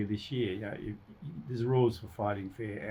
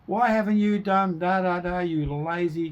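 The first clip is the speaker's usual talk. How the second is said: higher-pitched, worked-up scolding; upset accusation; 6 semitones higher than usual. Acted. Upset person blaming someone who hasn't done something, mock-exasperated scolding. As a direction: act out an exasperated telling-off. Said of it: "'cause they're really upset".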